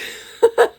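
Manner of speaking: sudden high laugh at own joke; squeaky amused laugh; high burst of laughter. Real, not acted. Laughs at her cat joke.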